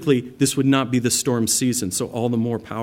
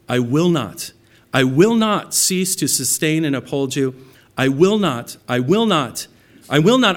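I will not, growing louder each time; building emphasis; 3 semitones higher, 6 dB louder than usual. Acted. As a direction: hammer "I will not" harder each repeat up to a near shout. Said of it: "the point is getting driven home".

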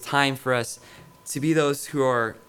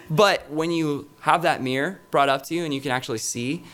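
ordinary delivery

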